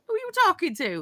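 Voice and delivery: high, amused "who are you talking to"; mock the husband's line with a laugh; imagining the husband talking dirty, laughing disbelief. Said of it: "it's like".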